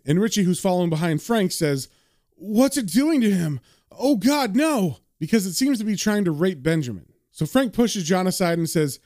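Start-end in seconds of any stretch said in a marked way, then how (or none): 2.4-5.0 horrified cry. Acted. Richie voiced, horrified.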